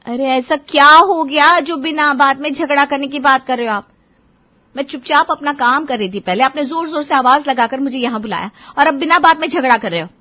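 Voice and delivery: defensive wife. Acted. Wife defends herself to her quarrelsome husband, indignant. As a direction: defend yourself against his picking a fight.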